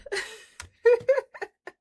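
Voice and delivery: bright high burst of giggling; high giggle. Real, not acted. Streamer giggling at her snowman, high and delighted.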